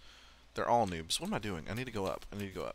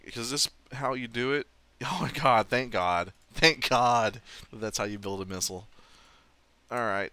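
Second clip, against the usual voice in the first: usual speaking voice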